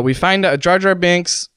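ordinary talk, nothing marked

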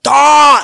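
loud, high furious yell with no words; angry scream. Retold or imitated. Friend screaming in rage before punching a door. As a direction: let out a raging battle cry. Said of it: "yelled out, like, a scream".

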